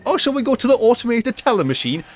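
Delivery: high, prim automated teller machine; lightly voiced prissy speaker; mock posh. Mocking people who say the full name, high and prim. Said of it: "people don't walk around going".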